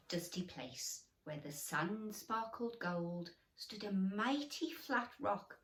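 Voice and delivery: plain reading voice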